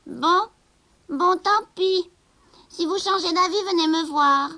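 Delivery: high sly resignation, slow start; witch. Acted. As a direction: leave the door open slyly. La sorcière cède, rusée. (sorcière rusée, voix aiguë)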